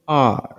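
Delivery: আর as long "aah", R barely sounded; correct letter pronunciation. Shown as a demonstration. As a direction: hold the vowel of আর, hardly touch the R. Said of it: "কিভাবে বলবো?".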